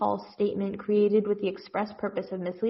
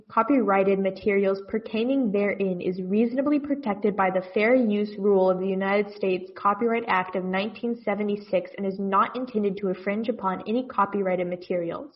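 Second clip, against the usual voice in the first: ordinary talk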